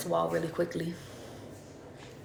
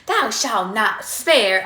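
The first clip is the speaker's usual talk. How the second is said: put-on slow, louder, theatrical old-English reading; mock Shakespearean recital; 2 semitones higher, 7 dB louder, much slower than usual. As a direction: declaim it like a ham stage actor doing Shakespeare. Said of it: "I gotta perform".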